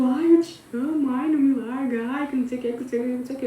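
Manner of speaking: a hint of a sobbing man; mock crying ex. Imitating her ex begging her not to leave, mock whine. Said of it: "ele começou a chorar na frente".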